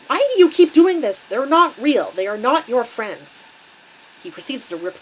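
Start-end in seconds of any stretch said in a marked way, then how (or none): 0.1-3.4 loud, higher-pitched furious yelling; angry shout. A narrator's reading. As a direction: shout it as an outraged father scolding. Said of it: "He takes the curtains from her and shouts".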